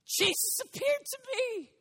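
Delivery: rally the listeners with fire; preaching. Acted. Preaching call.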